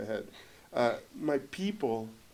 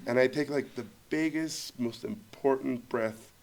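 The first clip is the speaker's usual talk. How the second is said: ordinary delivery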